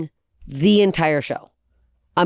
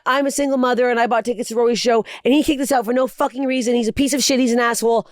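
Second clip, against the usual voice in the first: higher-pitched imitated complaint; mock outraged post; 5 semitones higher than usual. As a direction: rant as the wronged customer, pitched up. Voices woman's outraged online post.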